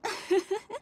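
high, rising amused chuckle; a sly little laugh of amusement; knowing laugh. Acted. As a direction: laugh as the secret comes out. Mama reveals her identity.

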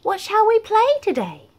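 high squeaky little mouse voice; cheerful squeaky mouse asking to play. A narrator's reading. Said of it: "squeaked Mouse".

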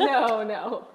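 loud "no" through real laughter; laughing denial. Real, not acted. Laughing along at the misunderstanding.

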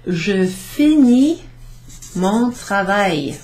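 je finis said slow and clear, finis as fee-NEE; slow French model phrase. Shown as a demonstration. Teacher modelling the French for her class, slow and clear. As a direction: sound out je finis mon travail slowly. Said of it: "I'm finishing my work".

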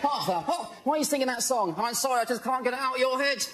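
ordinary delivery

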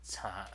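soft "cha" in Mandarin second tone; Chinese second tone. Shown as a demonstration. Streamer showing how the character is pronounced, soft second-tone "cha". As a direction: say "cha" quietly as a rising-tone example. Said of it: "so that's pronounced that".

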